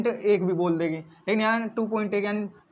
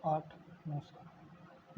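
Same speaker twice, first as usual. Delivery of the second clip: whispered digits; 7 semitones lower, 18 dB quieter, much slower than usual. Shown as a demonstration. Digits read out slowly.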